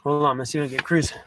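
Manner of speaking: ordinary delivery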